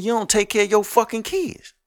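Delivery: normal talking voice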